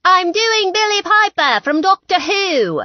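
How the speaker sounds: put-on yelled British accent. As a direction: yell it in a fake British accent. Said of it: "You're yelling".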